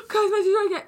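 high, loud hammed-up wail; mock drama. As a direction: overplay a tragic announcement. Mocking a dramatic overreaction.

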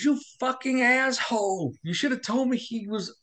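high-pitched slow mock yell at a friend; doctor yelling at the friend who brought the patient in, high mock yell; retold screaming doctor. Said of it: "The doctor kept screaming".

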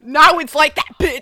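loud, hard-punched comeback; mock-angry retort. Answering the acted text fight, loud and confrontational. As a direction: throw it back loudly, as in a quarrel.

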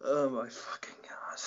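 a hushed, slow groan at an awful joke; disbelieving whisper. Real, not acted. Host groaning at the guest's terrible knock-knock joke, whispered. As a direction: breathe it out in stunned disbelief.

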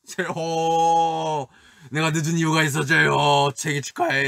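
진영 announcing. Retold or imitated. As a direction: imitate an excited friend, stretching the called name. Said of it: "이러면서 이거 주더라고요". (형 흉내, 크고 들뜬 외침)

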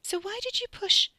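aghast. Acted. Catherine cuts in, aghast.

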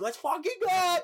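high, thrilled shout of joy; pumped-up celebration; hyped cheer. Retold or imitated. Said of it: "now we're like".

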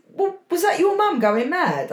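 put-on high, wide-eyed teen friend asking; friend's disbelieving question. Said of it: "And they said".

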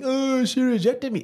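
high, slow mocking whine; mock sulking. Mocking fellas sulking over rejection, whiny sulk. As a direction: imitate a drawn-out, high-pitched sulk. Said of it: "while you're sitting here sulking".

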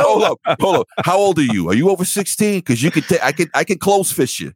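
high, hammed-up tough dad; mock menacing dad talk; protective dad threat. Threatening daughter's young suitor.

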